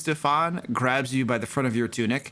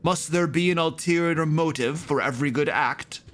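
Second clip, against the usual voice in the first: priest's raised, higher-pitched challenge; indignant rebuke; 4 semitones higher than usual. Acted. Priest rebukes request for reward.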